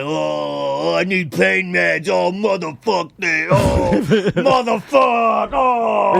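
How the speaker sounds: hammed-up complaining patient; mock yelling. Angry patient yelling at the nurses, drawn-out mock yell. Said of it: "he's, like, yelling the whole time".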